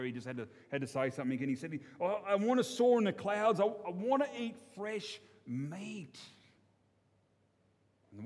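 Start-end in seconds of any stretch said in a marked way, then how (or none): 2.0-6.2 wistful plea. Acted. Davo pleads his wish.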